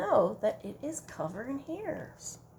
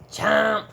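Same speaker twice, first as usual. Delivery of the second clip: chomp; 9 dB louder than usual. Shown as a demonstration. Ladybug munching.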